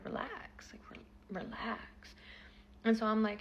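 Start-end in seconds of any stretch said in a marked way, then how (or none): 0.1-2.1 dismissive hush. Retold or imitated. Brushing off angry texter.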